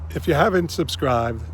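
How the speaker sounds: fighting back tears, a bit choked; choking up. Real, not acted. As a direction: voice catching with emotion. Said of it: "excuse me, I'm choking up".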